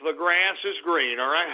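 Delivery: self-soothing mantra. Acted. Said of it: "take a couple of deep breaths".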